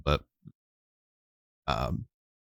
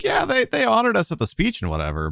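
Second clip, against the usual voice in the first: high, breezy and offhand; cocky soldier voice. Acted. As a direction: toss off a casual complaint.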